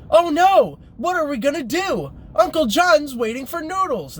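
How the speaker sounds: hammed-up alarm, squeaky and high; parodying the video's mom in falsetto; mock mom from video. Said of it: "her mom comes in and says".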